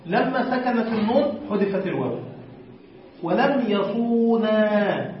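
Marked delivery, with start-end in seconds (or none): 3.2-5.1 ولم يصونا spelled out slowly, sound by sound; slow example form. Shown as a demonstration. Teacher showing students the kept waw, slowly. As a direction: lay it out slowly so the class hears the waw. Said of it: "لماذا بقيت الواو هنا".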